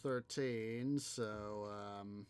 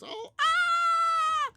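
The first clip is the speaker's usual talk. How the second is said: piercing high squeal of delight; thrilled shriek; 14 dB louder than usual. Retold or imitated. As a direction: shriek with joy at the news. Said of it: "how she goes".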